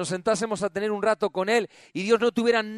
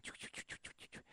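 faint unvoiced chu chu; whispered chatter; 28 dB quieter, faster than usual. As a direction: whisper it barely audible. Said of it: "Y las oíamos". (cuchicheo susurrado casi inaudible)

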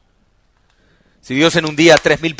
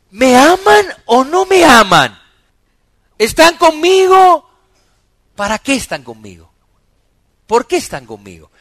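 pleading Christ; 10 semitones higher, 9 dB louder than usual. Acted. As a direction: ask it as if begging for an answer. Voicing Christ asking.

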